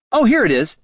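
high, pleased find; eager high voice. A narrator's reading. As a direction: chirp it out, delighted. Niln character voice.

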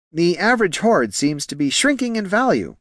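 high-pitched, worried subordinate voice; deferential junior clerk. Acted. Clerk warning his bosses about shrinking hordes, nervous. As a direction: offer bad news gingerly, pitched high.

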